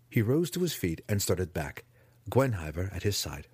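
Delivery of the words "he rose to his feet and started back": ordinary delivery, nothing marked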